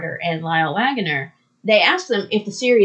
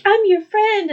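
high mock plea; earnest high-pitched heroine impression; pleading Wonder Woman; 11 semitones higher, 4 dB louder than usual. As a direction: insist on friendship in a high voice. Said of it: "Wonder Woman's, you know, like".

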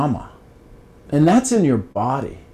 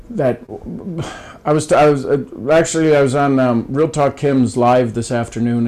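ordinary talk, nothing marked

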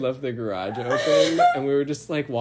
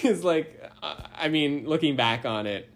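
ordinary talk

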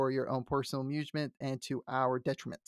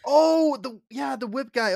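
sudden high gasp of recognition; oh, that guy!; surprised recognition; 8 semitones higher, 7 dB louder than usual. Real, not acted.

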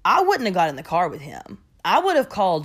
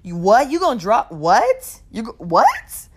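incredulous what; 3 semitones higher, 6 dB louder than usual. Retold or imitated. Incredulous retelling of his demand.

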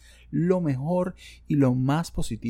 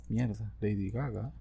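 normal talking voice

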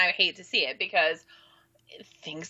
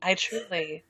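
sexy voice; 3 semitones lower than usual. Shown as a demonstration. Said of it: "you have to say it in the sexy voice".

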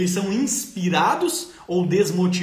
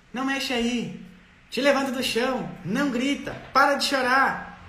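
put-on higher-pitched bossy orders to a child; scolding parent; 7 semitones higher than usual. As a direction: snap out commands like an impatient parent.

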